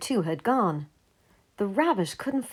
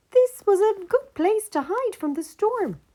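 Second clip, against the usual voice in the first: high squeaky little mouse; a tiny mouse offering shelter, very high; small mouse voice; 14 semitones higher, 4 dB louder than usual. Acted. Mouse offering shelter to the geese, high and small.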